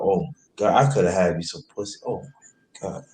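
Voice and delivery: usual speaking voice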